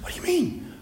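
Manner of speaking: high, whispered squeak of disbelief; imitated frightened passenger. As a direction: play the scared man, small and high. Acting out the frightened passenger's reply.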